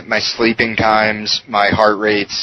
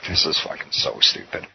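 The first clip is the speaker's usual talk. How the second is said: whispered under the breath, much softer; muttered aside; 5 semitones lower, 8 dB quieter than usual. Retold or imitated. Muttering to himself before walking into the doctor's office, whispered and fed up. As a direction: grumble it to yourself so nobody hears.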